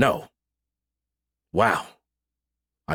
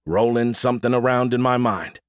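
ordinary delivery

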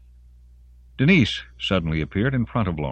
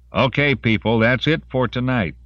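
raised, higher sergeant's voice; commanding announcement; 7 semitones higher, 5 dB louder than usual. A narrator's reading. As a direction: address the whole crowd with authority. Sergeant dismisses the crowd.

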